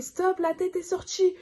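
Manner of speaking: high, slow announcement; announcing. Retold or imitated. Midwife to the mother, high and slow. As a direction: tell her gently it's out.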